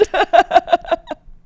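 high, loud burst of laughter; pained laugh. Real, not acted. Host laughing about her rejected show, loud and high. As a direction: laugh off a painful letdown.

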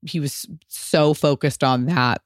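ordinary talk, nothing marked

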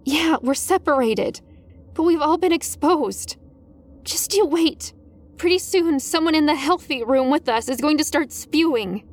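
high voice climbing into panic; escalating panic. A narrator's reading. Student to best friend about being exposed, escalating panic. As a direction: build from tense argument to frantic plea. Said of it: "my voice was escalating in panic".

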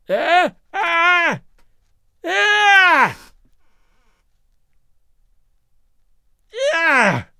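high shrieking screams of terror; terrified shriek. A narrator's reading. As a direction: shriek in mortal fear. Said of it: "the old man's shriek".

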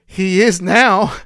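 slow, loud, flat-out matter-of-fact; mock patient husband spelling it out; deadpan reply. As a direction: lay it down slowly for her. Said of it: "And I go".

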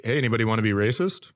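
ordinary delivery

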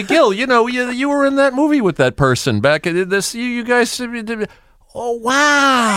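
high, fading fake interest; fake enthusiasm. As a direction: pretend to be fascinated. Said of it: "when he fakes enthusiasm".

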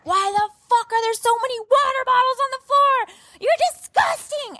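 imitating yelling at Garrett in the car; mock screaming. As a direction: shriek it like a woman in labor. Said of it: "I am screaming at Garrett".

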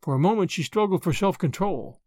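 usual speaking voice

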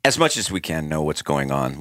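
ordinary talk, nothing marked

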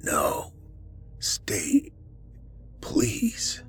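weak, dry-mouthed whisper; barely voiced, word by word; gravelly whisper. A narrator's reading. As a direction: plead in a soft, halting rasp. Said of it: "his voice coming out in a gravelly whisper".

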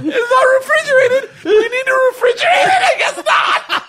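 high squeaky laughter through words; words squeezed out while cracking up; laughing hard. Real, not acted. Helpless laughter over the ham.

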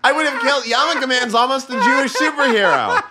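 giggling through the words; amused, laughing delivery. Real, not acted. Laughing about the nickname upgrade.

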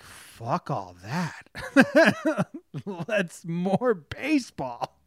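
drawn-out exclamation. Retold or imitated. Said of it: "and I'm like".

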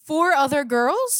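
loud, slow, high disbelief; incredulous. Retold or imitated. Retelling news of four other girls, incredulous. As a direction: stretch out the shocked question, re-enacted. Retold shock at boy's cheating.

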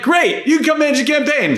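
squeaky take-charge Andrew; teasing Andrew with a high mock voice. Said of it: "And you're like".

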